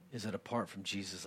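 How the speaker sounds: normal talking voice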